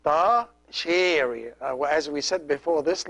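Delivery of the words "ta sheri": "ta sheri" said slowly, syllable by syllable; careful classroom model of "ta sheri"; slow teaching demo. Shown as a demonstration. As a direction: spell out "ta sheri" slowly for students. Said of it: "ta sheri means my daughter".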